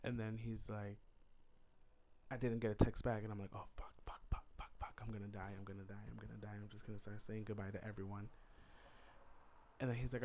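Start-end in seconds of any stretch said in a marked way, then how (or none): 3.5-8.3 frantic whispered cursing, voice hushed; whispered panic. Retold or imitated. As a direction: panic quietly so nobody in the apartment hears. Panicking while host fetches jacket.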